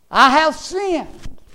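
high, loud, drawn-out confession; cried-out confession. Acted. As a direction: cry it out slowly, as a preacher quoting a sinner. Prodigal son's confession, acted out.

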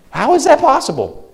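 high and loud disbelief; incredulous. Real, not acted. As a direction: throw up your hands in disbelief. Daughter laughs at spanking.

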